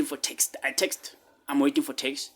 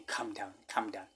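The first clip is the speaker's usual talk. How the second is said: inner voice, hushed; 5 semitones lower, 11 dB quieter than usual. Retold or imitated. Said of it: "something said to me".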